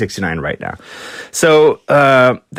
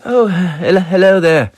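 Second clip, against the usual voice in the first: higher, put-on friendly greeting; polite greeting; 6 semitones higher than usual. Mick greeting an old fan, high and cheery. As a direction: greet her like a charming star. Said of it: "sees her and goes".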